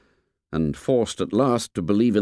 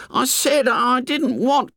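put-on high, drawn-out whining boy protest; apprehensive protest; slower than usual. A narrator's reading. Piggy objects to the nickname, high apprehensive protest. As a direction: protest as a pleading boy, pitched way up. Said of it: "clasped his hands in apprehension".

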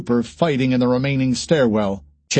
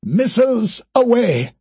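triumphant shout; 11 semitones higher, 3 dB louder than usual. Acted. Okoro launches missiles.

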